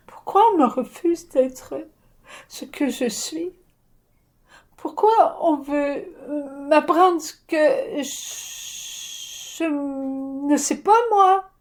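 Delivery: put-on high, slow, crying child's plea; a child near sobbing asking why; tearful child. Child protesting adults who won't accept her, tearful plea.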